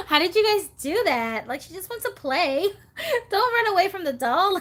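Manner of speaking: high, eager pleading through giggles; giddy, high-pitched mock protest; playful pleading. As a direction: plead the doll's case excitedly. Acted pleas to frightened video makers.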